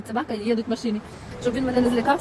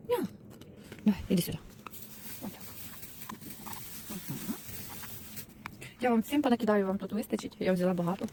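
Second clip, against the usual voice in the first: ordinary talk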